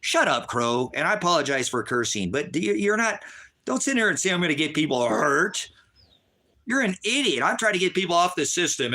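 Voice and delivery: high, strained shouting; furious rant at a chat troll; angry yell. Real, not acted. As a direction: blow up at the heckler. Ranting at a chat user.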